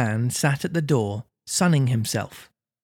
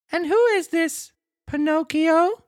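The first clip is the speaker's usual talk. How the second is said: very high puppet voice, innocent question; feigning ignorance. Acted. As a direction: play dumb as a squeaky little marionette. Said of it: "feigning ignorance".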